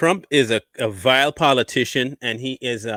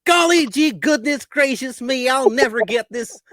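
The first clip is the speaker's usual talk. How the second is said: higher golly-gee innocent voice; aw-shucks goody-goody; fake wholesome; 9 semitones higher than usual. Hunter Biden parody voice.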